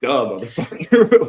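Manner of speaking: loud, slow mocking sneer; dismissive retort. Podcast host voices customer brushing off a bank ad, loud mocking sneer. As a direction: scoff it right back at them. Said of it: "It's like".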